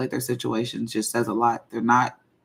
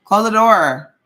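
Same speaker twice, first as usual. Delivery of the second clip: loud repeated call across the room; shouting; 9 semitones higher, 9 dB louder, slower than usual. Real, not acted. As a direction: yell it again so they hear. Yelling the call again.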